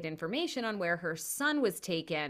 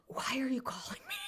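sleepy, put-out doctor on the phone; a doctor woken at night, grumbling; groggy doctor; 7 semitones higher, 5 dB quieter than usual. Retold or imitated. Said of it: "He seemed like".

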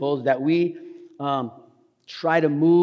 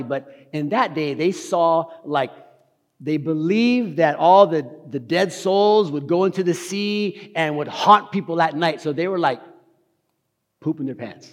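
ordinary delivery

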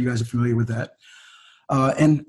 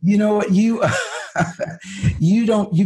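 laughing; 6 semitones higher than usual. Real, not acted. Laughing, fumbling to answer.

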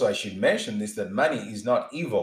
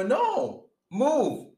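urgent move; 12 semitones higher than usual. Pretend. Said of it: "ask questions later".

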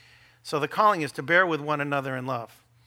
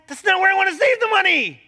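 higher, louder retort with a laugh; laughing mock retort; 13 semitones higher, 8 dB louder than usual. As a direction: fire back half laughing.

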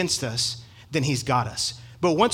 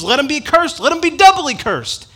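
high, loud furious curse; angry curse; 10 semitones higher, 7 dB louder than usual. Retold or imitated. Paul cursing the troublemakers, high and furious. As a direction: hurl the curse with rising fury. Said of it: "you could hear the anger that's in him".

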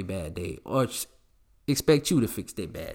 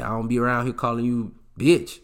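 ordinary delivery

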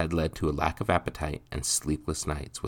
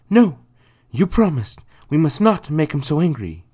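much higher and louder, pleading; anxious mother; 12 semitones higher, 11 dB louder than usual. Acted. Mother urging her son to hide from Nathan. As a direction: hold him to his promise in fear. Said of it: "her voice quivering with anxiety".

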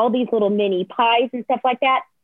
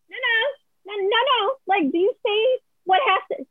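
high, small-voiced panicked protest; mock panicky backpedaling; imitated frantic pleading; 11 semitones higher than usual. Frantically pleading with quitting designer.

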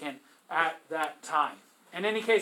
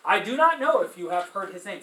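reading the poem aloud; 4 semitones higher, 8 dB louder than usual. Acted. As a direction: read the verse with a lift, a proud dead man speaking. Reads Dante aloud.

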